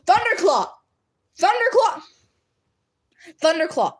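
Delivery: high, loud scolding shout of a name; mock-outraged callout. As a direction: call them out in playful indignation. Calling out a chatter in mock outrage.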